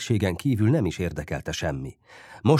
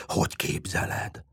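hushed, breathed protest; scandalised whisper; 5 semitones lower, 5 dB quieter than usual. A narrator's reading. Lovesick boy asked about kissing her, shocked whisper. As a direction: whisper it, as if the idea is unthinkable.

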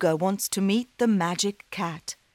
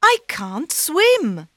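put-on worried boy; 10 semitones higher, 6 dB louder than usual. Daniel protests.

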